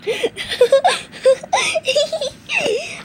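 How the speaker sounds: long high cackling laugh; high laugh breaking into a cackle. Acted. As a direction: cackle at the joke.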